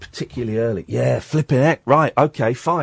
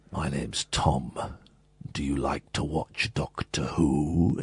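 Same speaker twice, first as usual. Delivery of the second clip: much lower, softer and slow, a gentle giant Doctor; slow deep voice; 12 semitones lower, 9 dB quieter, slower than usual. Retold or imitated. Tom Baker asking a young fan, deep and slow. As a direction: rumble it slowly down to a child. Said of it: "He said it in this slow, deep voice".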